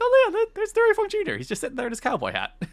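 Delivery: way up high in a silly put-on voice, airy; mock oblivious viewer. Host mocking her past self rewatching, high silly voice. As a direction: play the clueless past self who never noticed. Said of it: "it's like".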